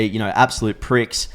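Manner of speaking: normal talking voice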